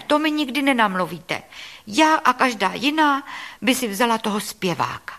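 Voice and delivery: put-on high-pitched, indignant child's outburst; protesting little girl. A narrator's reading. Little girl argues with grandmother about the ugly suitor, indignant protest. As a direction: refuse to believe it, pitched high. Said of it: "vykřikla Lizetka".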